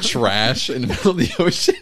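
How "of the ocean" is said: high-pitched, laughing through the words; breaking into laughter. Real, not acted. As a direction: crack up while finishing the question. Laughing at own joke.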